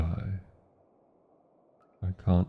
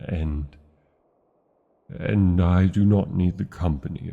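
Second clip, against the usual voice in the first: louder, forced stern dragon command; feigned commanding anger; 5 dB louder than usual. Acted. Dragon faking anger to drive the human companion away, stern command. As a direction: bark the order to make them leave.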